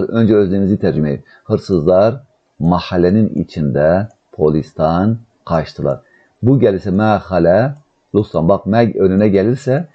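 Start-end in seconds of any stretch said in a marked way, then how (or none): 1.4-6.0 very slow, stretched word endings; word by word, with pauses between; slow dictation. Shown as a demonstration. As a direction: dictate slowly to a class. Teacher dictates the translation.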